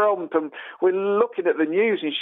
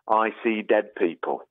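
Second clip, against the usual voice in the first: put-on spooky boy quote; 6 semitones lower, slower than usual. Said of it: "looks at Bruce Willis and says".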